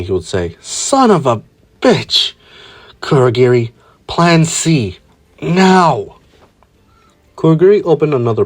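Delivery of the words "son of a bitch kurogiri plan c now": higher, hurried panic; panicking. A narrator's reading. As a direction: shout orders in a high-pitched panic. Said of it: "Shigaraki panicking".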